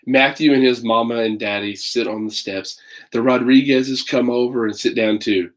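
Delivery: put-on lively read-aloud for children; story narration. A narrator's reading. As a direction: tell it like a picture book to kids. Reads picture book aloud.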